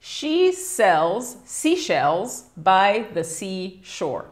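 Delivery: careful teacher's read-aloud of the tongue twister; model tongue twister reading. Shown as a demonstration. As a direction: model every s and sh in "seashells" distinctly. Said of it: "can you please read it for us".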